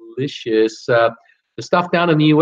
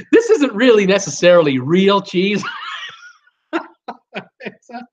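fighting back laughter; 4 semitones higher than usual. Real, not acted. Host retrying his cheese confession to viewers, fighting back laughter. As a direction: force it out before the laugh bursts. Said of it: "I'm trying to say this without laughing".

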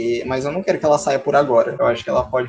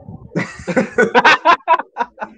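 laughing loudly at the mishap; high laugh; 9 semitones higher, 3 dB louder than usual. Real, not acted. Laughing after the fall.

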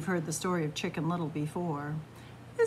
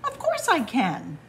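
slightly raised, prompt retort; quick reply; 5 semitones higher, 4 dB louder than usual. A narrator's reading. Fox answers the doubting chickens, replying quickly. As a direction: jump in with a ready answer. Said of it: "Fox said quickly".

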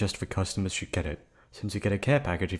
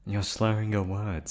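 normal talking voice

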